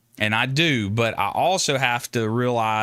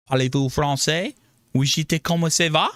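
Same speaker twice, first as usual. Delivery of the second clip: quick, higher French phrases in a put-on French voice; showing off French; 5 semitones higher than usual. Shown as a demonstration. Streamer testing his French on chat, quick put-on French. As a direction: rattle off schoolbook French with flair. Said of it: "What about my French?".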